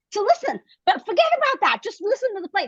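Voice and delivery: bursting to read him the play; eager. Retold or imitated.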